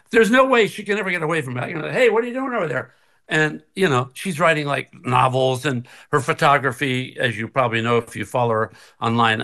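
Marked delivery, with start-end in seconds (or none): 1.9-3.0 nosy calling out. Retold or imitated. Imitates himself calling to his wife.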